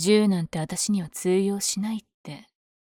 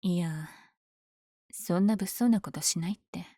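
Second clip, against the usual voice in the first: normal talking voice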